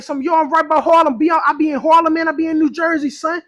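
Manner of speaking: mock New York street boasting; New York accent. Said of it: "my New York accent".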